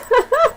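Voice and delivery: sudden squealing laugh; laughing at her own slip; high burst of laughter. Real, not acted. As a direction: let a high laugh escape. Laughs at forgetting a title.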